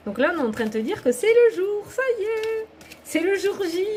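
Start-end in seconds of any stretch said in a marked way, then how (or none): 1.1-3.8 high, rising excitement; excited announcement. Real, not acted. Card reader tells viewer the big day has come, excited.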